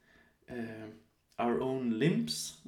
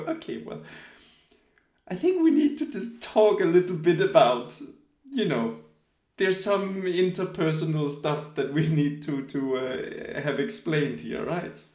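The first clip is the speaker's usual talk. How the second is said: higher and louder, a hammed-up careful parent talk; mock patient parent; 3 semitones higher, 5 dB louder than usual. Mother to son about biting Vilma, mock patient and louder. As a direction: play the mother choosing her words with a child. Girlfriend lectures son gently, retold.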